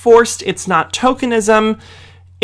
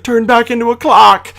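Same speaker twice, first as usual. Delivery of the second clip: lightly mimicked plea, pitched higher; a hint of a comic husband's plea; Cogsworth; 4 semitones higher, 4 dB louder than usual. Reviewer mimicking Cogsworth reunited with his wife, comic plea. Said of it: "He was like".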